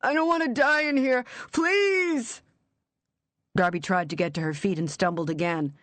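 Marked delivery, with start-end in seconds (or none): 0.0-2.4 much higher and louder, panic rising to a cry; terrified pleading. Acted. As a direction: plead desperately from the coffin. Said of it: "raw, terrified voice".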